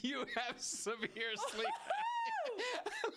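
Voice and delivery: high, slow words squeezed out through hard laughter; can barely get it out for laughing. Real, not acted. Cracking up while telling a friend she has severe sleep apnea, laughing.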